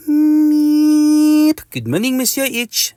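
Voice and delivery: put-on high meepy French-accented insect; Mister Mosquito. Mosquito greets his neighbour, meepy French voice. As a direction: open with a long high meep. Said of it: "in a meepy voice".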